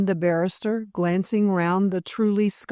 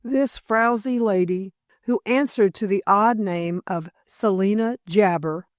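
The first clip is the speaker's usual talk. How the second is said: plain reading voice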